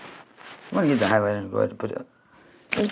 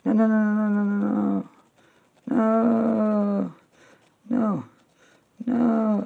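high distressed no's; 10 semitones higher, much slower than usual. Real, not acted. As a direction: cry out no over and over in dismay. Watching planes hit New York.

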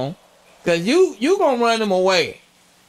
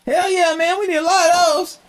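high mock voice; 12 semitones higher than usual. High voiced reply to the onions.

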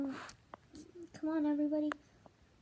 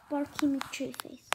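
ordinary delivery

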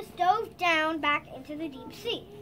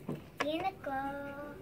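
soft, slow, lower repeat; fading echo; 5 semitones lower, 7 dB quieter, much slower than usual. Acted. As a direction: let the plea die away. Echo after shouted plea.

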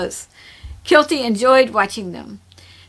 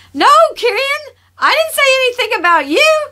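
much higher, louder playful shriek; squealing protest; 13 semitones higher, 6 dB louder than usual. Acted. Kirsha running from Kirian, squealing in mock panic. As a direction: squeal it as you run away.

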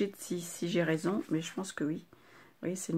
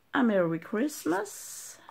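slow, a bit higher English-accent "Merry Christmas"; 5 semitones higher, 4 dB louder, much slower than usual. Shown as a demonstration. Showing viewers her English accent, slow and proud. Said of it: "Vous avez vu l'accent un peu?".

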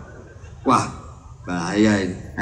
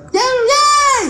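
very high squealed yeay, yeay; mock cheerleader squeal; 5 dB louder than usual. Comic imitates a cheerleader's cheer, high squeal.

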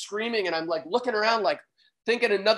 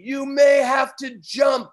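slow, raised shout over the surf; guide's shouted warning; 5 semitones higher, 6 dB louder, slower than usual. Retold or imitated. Jet-ski driver shouting a warning to his passenger, slow shout. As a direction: call the warning loudly and slowly. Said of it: "and he says to me".

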